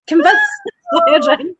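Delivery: high-pitched, cracking up through the words; laughing helplessly. Real, not acted. Joking to co-host about networking, laughing hard.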